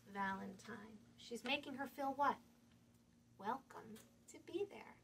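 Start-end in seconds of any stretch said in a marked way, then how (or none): none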